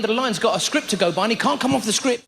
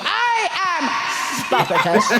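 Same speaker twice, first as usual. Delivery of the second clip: defiant Spartacus; 4 semitones higher, slower than usual. Retold or imitated. Customer defying the BT caller with the Spartacus line. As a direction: proclaim it like the movie. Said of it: "And we go".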